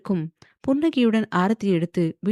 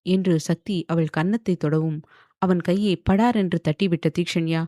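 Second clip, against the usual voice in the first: ordinary delivery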